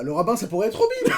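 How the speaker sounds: high pitch, about to explode laughing; helpless laughing delivery; bursting into laughter. Real, not acted. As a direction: blurt it out as the laugh takes over.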